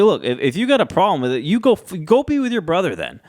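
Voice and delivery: mock-irritated dismissal, pitch climbing; snapping back. Roman sending Jimmy off to his brother, snapping back. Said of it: "Roman snaps back at Jimmy".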